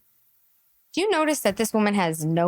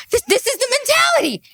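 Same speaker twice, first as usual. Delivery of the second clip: exasperated; 13 semitones higher, 4 dB louder than usual. Real, not acted. Exasperated outburst.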